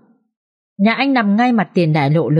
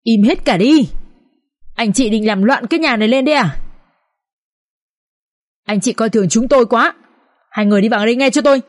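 stern scolding, mildly raised voice; irritated shout; 3 semitones higher than usual. A narrator's reading. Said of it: "bực bội và quát lên".

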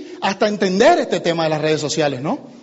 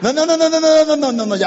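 higher, louder string of protests; impatient refusal; 6 semitones higher, 4 dB louder than usual. Acted. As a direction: wave off the excuse in a rapid run. Acted contact cuts the evasion short.

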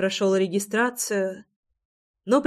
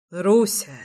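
resolute, a firm single word of address; firm and decided. A narrator's reading. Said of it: "решительно проговорил Реми".

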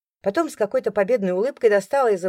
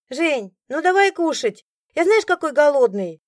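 put-on impatient boy; 8 semitones higher, 5 dB louder than usual. A narrator's reading. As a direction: pester her for food. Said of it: "в нетерпении".

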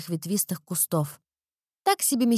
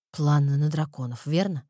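low vampire voice; 6 semitones lower than usual. A narrator's reading. Erik's low guess.

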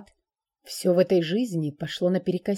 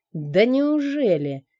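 slow, higher, louder snide hiss; sarcastic hiss; 4 semitones higher, 4 dB louder, slower than usual. Acted. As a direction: sneer it out mockingly. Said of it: "ехидно прошипел чёрный дракон".

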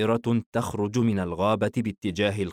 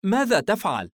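mother's surprised question, pitched up; higher, wondering mother's voice; puzzled; 11 semitones higher, 5 dB louder than usual. A narrator's reading. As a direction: ask in mild astonishment. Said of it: "الأم باستغراب".

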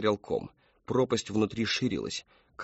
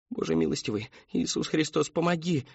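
imploring prayer; 6 semitones higher than usual. A narrator's reading. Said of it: "молила Мэри Кэтрин".

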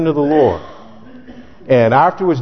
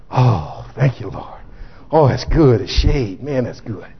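contented Jonah; 4 semitones lower, 3 dB quieter than usual. Acted. Jonah enjoys the shade.

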